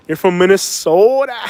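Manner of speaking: exaggerated Minnesota accent, drawing out the name; mock Minnesota accent. Teasing northerners who can't hide where they're from, hammed-up Minnesota accent. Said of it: "your accent's gonna give you up".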